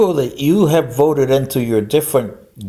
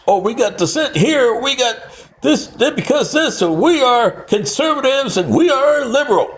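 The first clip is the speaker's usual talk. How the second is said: higher, mocking judges' voice; higher hammed-up justices; 10 semitones higher than usual. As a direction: parody their pompous reasoning, higher. Mocking Supreme Court justices' reasoning.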